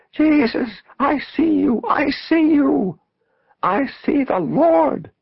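high, loud religious rapture; full-voiced, high-pitched ecstasy; ecstatic shout. A narrator's reading. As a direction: cry it out to heaven, stretching the words. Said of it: "she shouted ecstatically".

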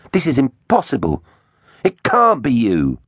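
high-pitched dismay; disbelieving cry. A narrator's reading. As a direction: cry out, refusing to believe it. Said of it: "Teggs cried".

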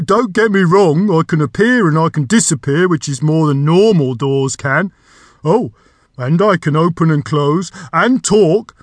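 loud, rambling door creature; chatty magic door. A narrator's reading. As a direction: prattle on defensively as a talking door.

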